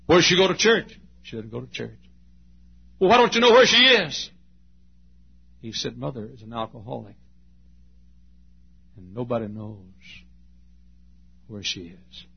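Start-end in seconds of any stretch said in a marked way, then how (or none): 0.1-1.0 higher and louder, insistent; a pastor's raised, urgent question; pressing question. Retold or imitated. 1.2-2.0 quiet son. Retold or imitated. Boy answers flatly. 3.0-4.3 much higher and louder, incredulous; a pastor's baffled, raised cry; exasperated outburst. Retold or imitated. 6.0-12.3 quiet sad admission. Retold or imitated. Son tells sad truth.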